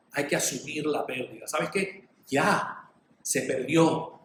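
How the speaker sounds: normal talking voice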